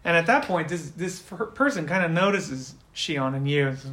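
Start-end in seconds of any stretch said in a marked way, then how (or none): none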